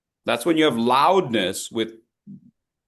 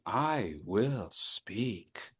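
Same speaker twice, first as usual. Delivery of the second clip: I will speak drawn out, very quiet; very slow and soft; 5 semitones lower, 11 dB quieter, much slower than usual. Shown as a demonstration. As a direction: model it slow, low and soft. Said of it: "Let's try that again".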